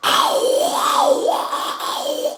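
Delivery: unvoiced screamed ow; whisper scream. Shown as a demonstration. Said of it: "this whisper scream where it's just like".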